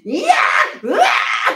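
loud, high panicked yell; screaming. Retold or imitated. Mother screaming through labour pains, loud high yell. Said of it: "って言って".

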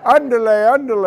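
a hammed-up olé from the crowd; mock yell. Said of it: "it seemed like something to yell".

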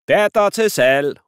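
loud, high, slow market cry; shouting. Acted. Dad teasingly selling his daughter, loud market cry. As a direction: hawk it like a market trader. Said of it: "råber han".

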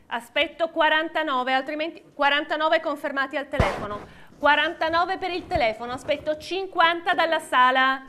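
rhythmic auction calling; auctioneer. Real, not acted. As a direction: call the bids like an auctioneer. Live auction, calling bids.

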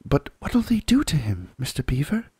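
breathless whispered gasp, high; frightened girl gasping the question. Acted. Lucy asking Mr Beaver in a frightened whisper. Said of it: "gasped Lucy".

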